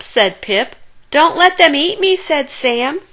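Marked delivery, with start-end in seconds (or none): 1.1-2.2 panicked plea. A narrator's reading. Sam panics at ghosts.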